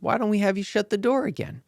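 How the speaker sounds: a hint of a patient old monk. Monk voiced in story.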